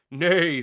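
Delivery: loud high horse neigh; whinny it out. Acted. Horse warning the farm, loud neigh. Animals make their sounds.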